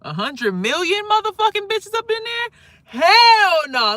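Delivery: shocked shouting refusal; outraged shout. Pretend. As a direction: explode at the thought. Outraged reaction to the idea.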